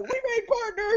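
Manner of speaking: very high-pitched giddy announcement; over-the-top retold excitement; ecstatic squeal. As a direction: squeal it like you've won big. Retells announcing big news.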